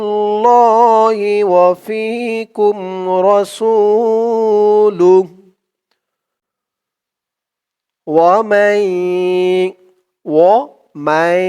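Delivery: slow, drawn-out chanted recitation; Quran recitation. Shown as a demonstration. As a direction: recite it slowly, stretching each vowel. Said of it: "Quran recitation demo".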